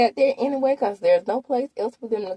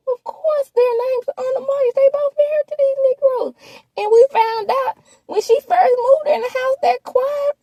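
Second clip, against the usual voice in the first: exasperated squeal; 7 dB louder than usual. Real, not acted. Exasperated reaction to mortgage talk.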